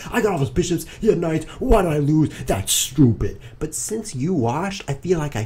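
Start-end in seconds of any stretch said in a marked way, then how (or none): 0.1-3.4 frustrated rant. Retold or imitated. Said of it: "'cause I'd be like".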